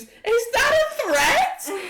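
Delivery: high, loud, drawn-out screech; screamed outburst. Acted. Game master as Archeon confronting Bartholomew, screaming. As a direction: scream it as an outraged challenge. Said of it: "Archeon screams".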